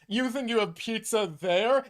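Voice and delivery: mock-smug European disbelief. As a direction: imitate someone smugly looking down on foreign pizza. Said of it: "now you act smug like".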